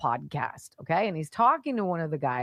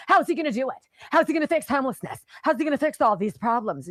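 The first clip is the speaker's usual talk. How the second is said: louder, higher mocking questions; mocking shout; 6 semitones higher, 4 dB louder, faster than usual. Host mimicking whiny critics, loud mocking questions. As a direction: jeer the critics' questions back at them.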